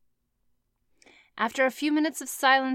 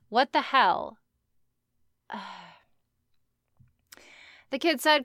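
ordinary delivery

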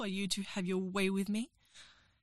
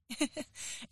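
soft giggle; 6 semitones higher, 5 dB quieter than usual. Acted. Giggle after teasing line.

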